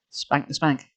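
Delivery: plain reading voice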